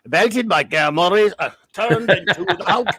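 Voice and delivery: mock Scottish accent, sung theme; Scotty impression. Fan performs Hulk theme on request, Scottish accent. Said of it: "do the Hulk one as Scotty".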